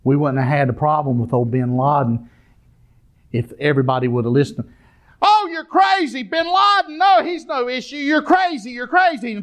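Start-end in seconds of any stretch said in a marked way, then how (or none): 5.2-9.3 high, mocking voice of the doubters; scoffing dismissal. Preacher mocking officials who dismissed the warning, scoffing. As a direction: sneer it like the know-it-alls.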